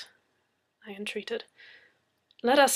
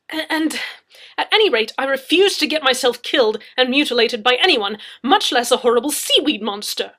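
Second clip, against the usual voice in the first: higher, louder indignant protest; 4 semitones higher, 13 dB louder than usual. Acted. Leslie protests to Anna, indignant. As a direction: insist it's not your job.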